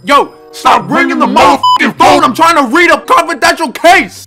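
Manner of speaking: high, loud annoyed shouting; irritated high yell at the phone. Real, not acted. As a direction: snap at the ringing phone. Yelling at the phone.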